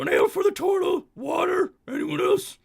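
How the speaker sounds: high, loud cartoon barmaid; troll barmaid voice. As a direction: take orders like a blunt troll. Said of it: "is like, "So".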